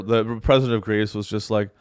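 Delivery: ordinary talk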